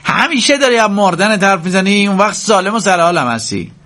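high, heated outburst; angry complaint flung while leaving; angry, exasperated. A narrator's reading. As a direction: snap back in exasperation, pitch way up. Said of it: "با غیظ گفت".

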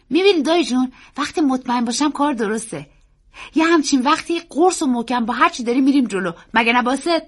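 put-on high, loud child's voice; confident boy. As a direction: explain eagerly, like a boy sure of himself.

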